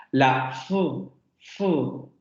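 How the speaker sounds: hissed "fu" with friction; "fu" with a long fricative f; demoed f sound. Shown as a demonstration. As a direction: let air rub through on "fu". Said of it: "estos cuatro fonemas".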